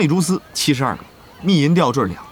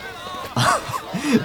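a short pleased chuckle, falling away; soft happy laugh; 5 dB quieter than usual. Acted. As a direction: laugh with delight at the new record. Pleased after record broken.

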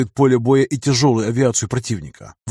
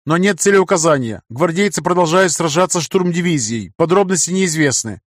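urgent report; 5 semitones higher than usual. Acted. Signalman reports to the emperor.

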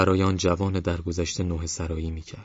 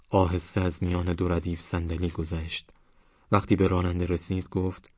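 plain reading voice

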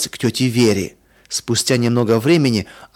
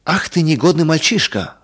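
higher-pitched outraged scolding; indignant; 6 semitones higher than usual. A narrator's reading. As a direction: scold a naughty boy, affronted. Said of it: "возмутилась Оля".

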